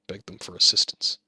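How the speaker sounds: usual speaking voice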